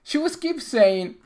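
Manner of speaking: ordinary talk, nothing marked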